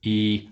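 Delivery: "E" held as a long ee. Shown as a demonstration. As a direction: stretch "E" out through a wide smile. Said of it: "how to practice the long E sound".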